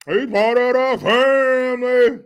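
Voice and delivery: loud, high mock politician; Barry Obama. Host echoes politician's family line, loud mocking impression. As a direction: belt it out as a hammed-up impression. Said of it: "Now you hear his voice".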